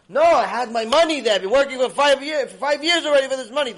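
loud, high, tumbling outburst; losing his temper. Retold or imitated. Guest who fears his savings are gone, acted temper outburst. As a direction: shout in mounting outrage, words piling up. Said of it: "So I was losing his temper".

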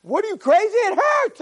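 high, incredulous protest; indignant retort. Retold or imitated. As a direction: throw it back at him like he's nuts. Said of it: "I'm like".

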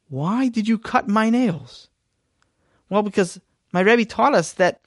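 put-on high, eager child's voice; young Sammy telling his father; earnest little boy. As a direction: proudly explain the good deed. Child Sammy explains cut nails.

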